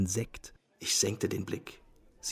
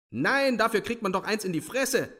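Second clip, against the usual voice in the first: very high indignant squawk; pitched-up outraged shriek; screeching; 12 semitones higher than usual. A narrator's reading. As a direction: screech it high without much extra volume. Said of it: "kreischte Ferfitschkin".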